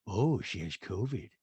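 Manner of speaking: low, slow mock deadpan; Rena impression. Rena reacts to the news, low and slow. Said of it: "And Rena goes".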